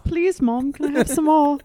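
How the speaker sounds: high-pitched mock-British orphan; Oliver Twist. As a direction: beg in a put-on British child's voice. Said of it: "you'd need Oliver Twist".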